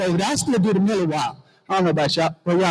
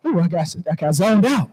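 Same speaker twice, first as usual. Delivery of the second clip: ordinary talk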